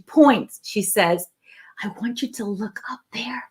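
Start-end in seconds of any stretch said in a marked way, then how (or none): 1.8-3.4 hushed, softer whisper; secret whisper. Retold or imitated. Coordinator points secretly at balcony.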